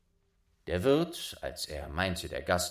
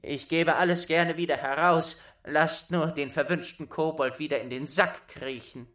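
meek pleading; 7 semitones higher, 4 dB louder than usual. Acted. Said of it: "rief der Wirt ganz kleinlaut".

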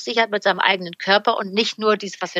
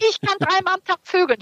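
high-pitched imitation of an elated shout; mock phone yell; 8 semitones higher than usual. Overjoyed patient boasts to the therapist by phone, high mock yell. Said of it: "schrie der durchs Telefon".

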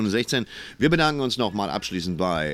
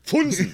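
loud, high mock crash noise; sudden high bang made by voice; imitated crash; 13 semitones higher, 4 dB louder than usual. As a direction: blurt out a comic impact sound. Imitates rain hitting like a crash.

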